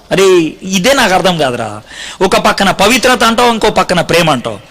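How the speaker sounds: ordinary delivery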